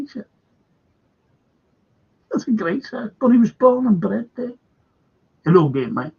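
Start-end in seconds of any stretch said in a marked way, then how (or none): none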